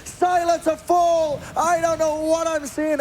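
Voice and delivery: high, slow hyped cast call; excited. Real, not acted. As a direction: build the call up big and slow.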